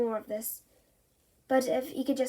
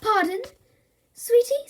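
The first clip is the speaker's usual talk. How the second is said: higher and louder, asking sweetly; startled mum; 11 semitones higher, 8 dB louder than usual. Acted. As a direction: pretend you misheard her. Mother shocked at toddler.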